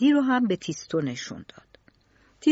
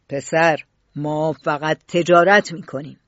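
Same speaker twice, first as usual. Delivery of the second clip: low, louder dismissive man; gruff factory boss; 5 semitones lower, 5 dB louder than usual. A narrator's reading. Tronadis brushing off the boy, low and gruff.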